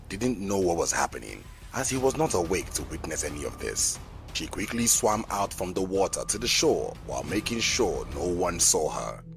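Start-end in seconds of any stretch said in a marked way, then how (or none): none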